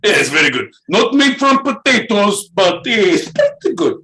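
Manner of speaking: broken-English Russian voice, dropped articles; hammed-up Russian accent. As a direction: play a boastful Russian. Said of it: "in a Russian accent".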